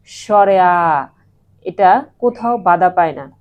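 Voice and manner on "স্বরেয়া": স্বরেয়া slow and loud, final vowel held; স্বরেয়া drawn out firmly as a model; pronunciation demo. Shown as a demonstration. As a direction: stretch the open ending of স্বরেয়া. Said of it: "তোমরা একবার উচ্চারণ করে দেখো".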